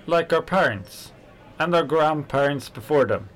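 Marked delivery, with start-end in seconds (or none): none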